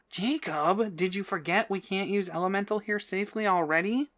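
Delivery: alarmed. A narrator's reading. Sylvie alarmed at the fire.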